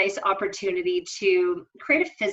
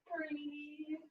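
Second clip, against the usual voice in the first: much softer, higher breathy cue; 10 semitones higher, 17 dB quieter than usual. Real, not acted. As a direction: breathe it out gently. Yoga cue, soft breath instruction.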